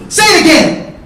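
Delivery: sudden high, loud shout; explosive yell, pitch way up; furious dare. Retold or imitated. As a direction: bellow it as a challenge. Re-enacted furious challenge.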